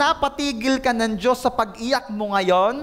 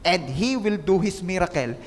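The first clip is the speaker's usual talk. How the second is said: low-pitched promise to the congregation; solemn assurance; 6 semitones lower than usual. Real, not acted. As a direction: drop the voice low and assure them. Promise lowered after rising list.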